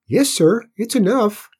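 high, obedient pipe; small squeaky Cinders. A narrator's reading. Cinders answers cross Tim, small high voice. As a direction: answer high and loud, eager to obey. Cinders agrees with his master.

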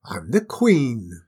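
imperious outburst, short of a full scream; screaming queen. A narrator's reading. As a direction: insist on royal rights, loudly. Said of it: "screamed the queen".

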